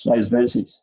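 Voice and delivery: usual speaking voice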